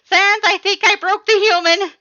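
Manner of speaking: very high, very loud panicked yell. A narrator's reading. Skeleton calling his brother upstairs, panicked yell. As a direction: shout up the stairs in alarm. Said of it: "as he yelled".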